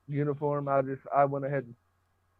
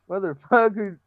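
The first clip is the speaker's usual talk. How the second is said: loud, high retold curse at the cadet; retold yell; 6 semitones higher, 6 dB louder than usual. Telling off a younger cadet who tried to search him, loud curse. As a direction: bark the insult back at him, half-laughing.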